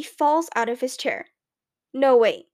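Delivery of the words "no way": loud, sudden blurt; abrupt outburst, much louder. A narrator's reading. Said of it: "he blurts".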